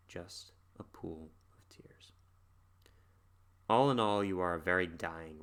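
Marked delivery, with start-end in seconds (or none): 0.1-2.1 slow breathless whisper of Just a pool of tears. Retold or imitated. Reciting the song's last line, slow whisper. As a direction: fade it to nothing, whispering.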